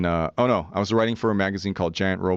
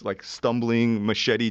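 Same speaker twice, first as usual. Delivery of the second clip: normal talking voice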